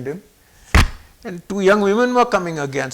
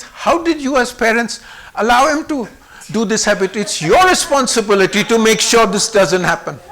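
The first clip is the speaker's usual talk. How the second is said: raised, emphatic scolding of parents; 3 semitones higher, 6 dB louder than usual. Retold or imitated. Baba takes the parents to task, scolding. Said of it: "So he first scolds them".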